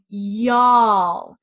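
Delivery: drawn-out Southern "Y'all", leaned on; Southern drawl. Shown as a demonstration. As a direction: stretch the vowel like a Southern drawl. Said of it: "you have a long Southern drawl".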